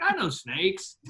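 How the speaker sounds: imitating a cocky snake handler; mock boast, pitch jumping up; Big Tom. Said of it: "That sounds like Big Tom".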